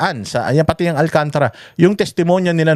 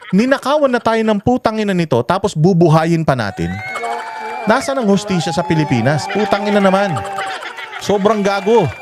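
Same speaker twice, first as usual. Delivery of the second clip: higher-pitched indignant tirade; angry rant; 6 semitones higher than usual. Real, not acted. Vlogger rages to viewers about taxes feeding thieves, indignant higher rant. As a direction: vent your outrage at the crooks.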